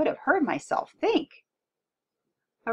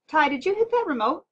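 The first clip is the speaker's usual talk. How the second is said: raised, high-pitched father's question; demanding question; 8 semitones higher, 6 dB louder than usual. A narrator's reading. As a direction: call out to the kid, wanting an answer. Father questions son as TV dies.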